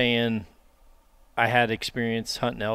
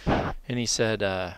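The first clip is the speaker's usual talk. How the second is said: normal talking voice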